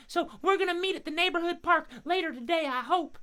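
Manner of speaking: very high, eager bird voice; friendly crow puppet. Pretend. Crow telling host about park meeting, high puppet voice. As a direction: share plans as a little cartoon crow.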